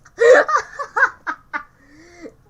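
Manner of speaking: loud, high burst of laughter; big laugh. Real, not acted. Cracking up at the video, loud high laugh. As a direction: crack up uncontrollably.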